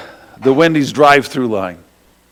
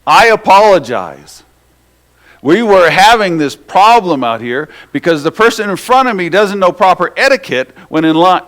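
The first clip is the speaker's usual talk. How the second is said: mock self-righteous; 6 semitones higher, 7 dB louder than usual. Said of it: "And he goes".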